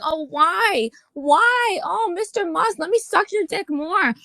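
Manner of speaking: high, simpering mock plea to Musk; mocking begging voice. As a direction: sneer it like a grovelling fan.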